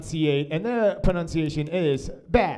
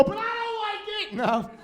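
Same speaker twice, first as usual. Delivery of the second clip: very high, softer squeal; comic cop, pitched way up; mock disapproving cop; 4 dB quieter than usual. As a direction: squeal the objection in a tiny high voice. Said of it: "I look like a cop".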